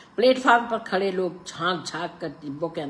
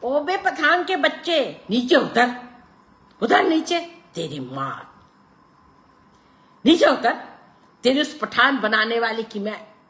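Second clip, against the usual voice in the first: much higher, loud furious cursing; screaming abuse; 9 semitones higher, 9 dB louder than usual. A narrator's reading. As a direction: scream insults at him. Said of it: "चीख चीख कर गालियां बकने लगा".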